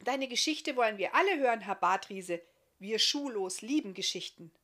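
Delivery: put-on a keen little boy begging for a tale; excited child Marti. Narrator voices the child Marti.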